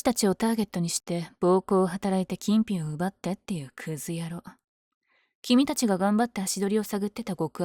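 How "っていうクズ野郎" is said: whisper. Acted. Scorning the robber.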